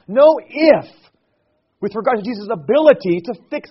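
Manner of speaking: loud, high, rising preacher's cry; preaching at full force. Acted. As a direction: proclaim it to the congregation with full force. Preaching crescendo.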